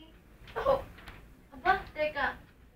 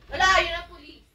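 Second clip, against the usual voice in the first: ordinary delivery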